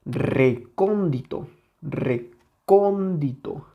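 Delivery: recóndito drawn out slowly, weight on CÓN; stress on the tonic syllable. Shown as a demonstration. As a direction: slowly lean on the CON of recóndito. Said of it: "dónde tiene su sílaba tónica". (recóndito con la sílaba CÓN muy marcada)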